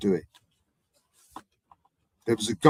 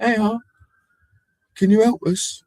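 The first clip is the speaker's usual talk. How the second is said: calling out for help; 14 semitones higher, 6 dB louder than usual. Retold or imitated. Stranger calling for bike help.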